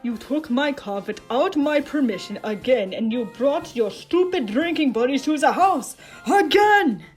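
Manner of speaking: loud, rising tirade; angry scolding. Acted. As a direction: scold him, building to a shout. Acted scolding of brother.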